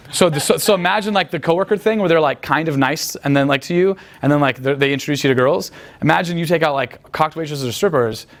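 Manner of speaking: ordinary delivery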